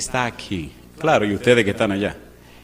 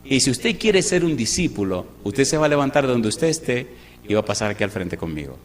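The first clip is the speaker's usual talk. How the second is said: normal talking voice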